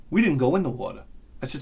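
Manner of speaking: usual speaking voice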